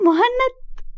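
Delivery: high laugh, then a disbelieving question; incredulous laughter. A narrator's reading. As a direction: repeat the name through laughter. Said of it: "وقالت بذهول وضحك". (ضحك وعدم تصديق)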